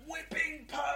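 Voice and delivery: high mock shout from the back of the crowd; imitating a heckler's shout. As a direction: yell the song title like a fan in the audience. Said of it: "Somebody famously shouts".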